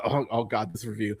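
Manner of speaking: ordinary delivery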